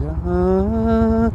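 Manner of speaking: sustained "ah" drone; pre-pitch hum. Shown as a demonstration. Rider showing friends his pre-attempt ritual, sustained hum. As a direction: hold one steady humming note. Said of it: "He hums before he goes to pitch".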